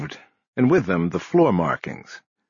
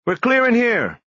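high, held call; yelling; 14 semitones higher, 3 dB louder than usual. A narrator's reading. Officer calling to the team from inside a room, high yell. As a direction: yell the all-clear at a high pitch. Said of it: "a voice yelled".

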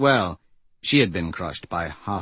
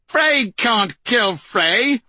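high and slow, each word laid down; high slow insistence; 12 semitones higher, 5 dB louder, much slower than usual. Acted. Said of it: "Aryan insists, slowly".

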